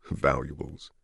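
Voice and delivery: low, soft, inward thought; hushed echo of a word just heard; private scornful echo. Acted. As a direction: drop right down, musing to yourself. Richards silently echoes the doctor's word.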